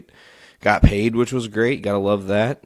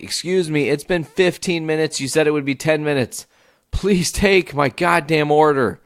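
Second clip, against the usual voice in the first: higher-pitched, insistent customer protest; exasperated complaint; 6 semitones higher than usual. Retold or imitated. Customer complaining after fifteen minutes of waiting, exasperated. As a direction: complain insistently, dragging out the final demand.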